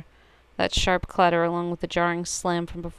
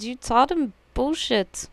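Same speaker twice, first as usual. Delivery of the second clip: ordinary delivery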